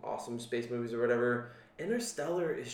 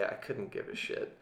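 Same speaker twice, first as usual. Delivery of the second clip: whispered; 4 semitones lower, 8 dB quieter than usual. Real, not acted. Defiant aside about others' opinions.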